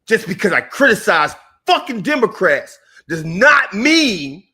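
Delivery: usual speaking voice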